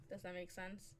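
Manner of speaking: low-key, softly asked question; quiet check. Real, not acted. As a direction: ask it softly, almost to yourself. Asks softly, then repeats louder.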